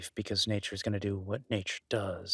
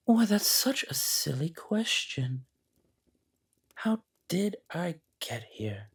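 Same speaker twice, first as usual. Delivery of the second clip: higher, mocking, otherworldly calm; ancient creature voice; 3 semitones higher, 4 dB louder than usual. Ancient creature taunting the armed guest, higher and mocking. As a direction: purr it like a timeless thing amused by the guest.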